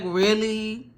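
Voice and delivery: fed-up disbelief; exasperated really. Retold or imitated. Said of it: "I was just like".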